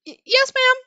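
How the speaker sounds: high, loud timid squeak; mewling squeak. Acted. Narrator squeaks to the scolding woman, timid. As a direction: squeak it out meekly. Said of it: "my mewling squeak".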